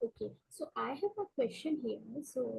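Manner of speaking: too quiet. Real, not acted. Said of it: "Your voice is very low".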